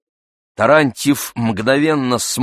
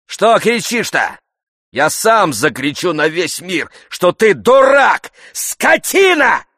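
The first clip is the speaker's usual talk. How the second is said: shouting; 9 semitones higher, 4 dB louder than usual. A narrator's reading. Tarantyev hurling insults at Oblomov, shouting. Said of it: "кричал Тарантьев".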